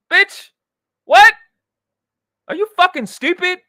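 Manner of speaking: high, loud disbelieving snap; real shock turning to scorn; incredulous outburst. Real, not acted. Streamer reacting to her denial, loud and incredulous.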